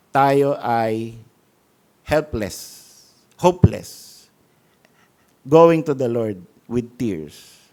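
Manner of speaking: low, slow, heartfelt exhortation; tender preaching. Acted. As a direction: bring them to humble surrender.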